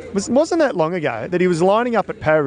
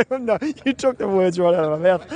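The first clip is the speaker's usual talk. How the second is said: laughing; 2 semitones higher than usual. Real, not acted. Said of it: "now I'm actually really laughing".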